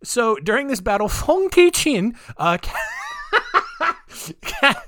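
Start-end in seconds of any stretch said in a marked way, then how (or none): 1.1-2.1 high, loud, slow Vietnamese-accent imitation; mock Vietnamese accent. Shown as a demonstration. Host naming the battle's captain, mock Vietnamese accent. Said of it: "I've gotta do the accent to say it right". 2.6-4.8 real high-pitched laughing fit; cracking up. Real, not acted. Host cracking up after saying the name, laughing helplessly.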